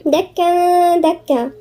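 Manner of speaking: slow دكا دكا with a held nasal between the words; ghunnah of ikhfa. Shown as a demonstration. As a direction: hum the "n" ending the first دكا before the second. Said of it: "غنة إخفاء". (غنة إخفاء ممدودة في "دكا دكا")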